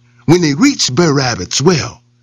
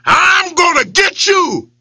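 yelled; 12 semitones higher, slower than usual. Acted. Lion yells at his reflection, threatening. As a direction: yell it at your rival. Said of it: "he yelled at it".

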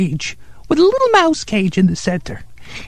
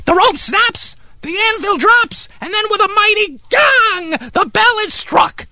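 high-pitched, thrilled build to the climax; excited climax; 7 semitones higher than usual. Acted. Telling Steve how the piece ends, excited and dramatic. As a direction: tell it as a grand dramatic payoff.

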